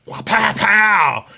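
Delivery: high, loud, slow wah pow pow; knife whoosh effect. Retold or imitated. Showing off his new knife to the rival, loud slow whoosh and pow. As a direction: swing the sound out like a blade flourish.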